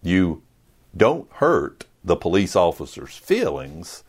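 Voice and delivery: ordinary talk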